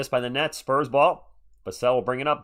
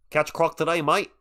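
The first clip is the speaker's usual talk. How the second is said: louder, higher put-on Australian accent; 5 semitones higher, 4 dB louder than usual. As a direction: do a playful Australian accent for the chat. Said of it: "can you do an Australian accent".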